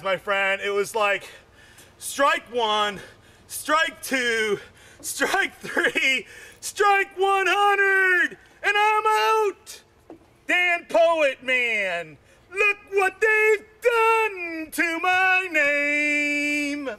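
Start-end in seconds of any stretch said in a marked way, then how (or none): 2.0-9.8 from plain counting up to a high, drawn-out shout; starts plain, ends in a slow, high yell; building strike count. Acted. 10.5-16.9 slow, high, shouted complaint; hammed-up slow yell; mock outrage. Mock outrage at his misspelled name.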